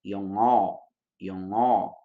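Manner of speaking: yong-o, ng carried into a bare o; Korean 용어 model. Shown as a demonstration. Teacher modeling Korean for students, careful pron. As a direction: say yong-o with no G sound. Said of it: "como exemplo a palavra yong-o".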